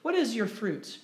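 normal talking voice

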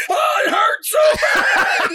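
high, slow agonized wail; hammed-up loud cry of pain; screaming in pain. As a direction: imitate a man yelling in agony. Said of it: "and he's screaming".

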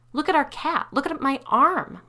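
high, loud angry pleading; loud, outraged wife pointing at proof; upset protest. Acted. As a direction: make them look, furious. Sally furious, showing the damage.

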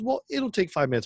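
2 high pushy sales voice; 1 mock saleswoman. 4 saleswoman pressing him for five minutes, high wheedling. Girl insisting.

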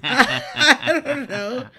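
laughing it out, slow and loud; breaking into real laughter mid-line; loud, slowed words through a laugh. Real, not acted. Cracks up retelling Rosie's words.

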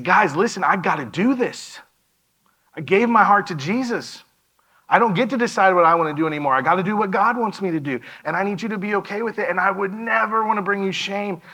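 high, loud, urgent rush of words; overwhelmed outpouring. Retold or imitated. Son pleading with his parents, overwhelmed and urgent. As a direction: pour it all out, pleading. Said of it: "it overwhelmed out of me".

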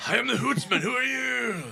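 high, booming self-introduction; in-character yelling. Acted. Player's character announcing himself to a startled stranger, yelling. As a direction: holler it like a grand proclamation. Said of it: "Yes, I'm yelling".

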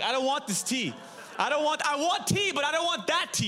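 retelling a frantic no to the tea; panicked refusal. Retold or imitated. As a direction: blurt it out in comic panic.

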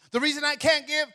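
normal talking voice

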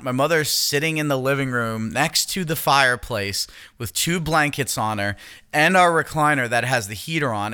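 ordinary delivery

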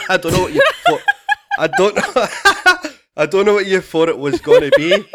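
helpless laughter breaking up the words; can barely get it out for laughing. Real, not acted. Cracking up at the memory.

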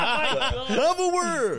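very loud, high, long-stretched shout; hammed-up, drawn-out crowd call; mock club DJ. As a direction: holler it slowly like a hype DJ. Mimics club DJ shouting show name.

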